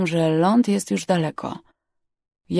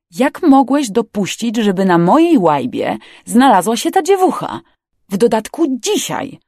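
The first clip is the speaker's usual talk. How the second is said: higher, louder, swooping scolding; indignant reprimand; 5 semitones higher, 5 dB louder than usual. Acted. Captain blaming Simmons for the girl aboard, indignant. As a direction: take him to task, incredulous.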